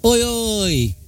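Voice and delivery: calling out playfully to Liam; mild mock shout. Calling through the bathroom door to her mate, a mild cheeky shout. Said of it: "So I shouted in a way to Liam".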